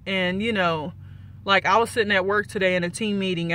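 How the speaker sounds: usual speaking voice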